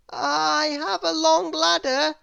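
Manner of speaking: high, slow, fretful little man; Mister Worry voice. A narrator's reading. Mister Worry begging Little Miss Somersault from below, high and slow. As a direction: plead up high and slow like a worrier. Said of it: "he added".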